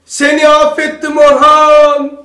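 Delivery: loud, high, slow cry of forgiveness; shouting forgiveness. Shown as a demonstration. Preacher shows shouting forgiveness alone in a room, loud and slow. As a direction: call it out loud and drawn out, three times. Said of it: "Bağırarak".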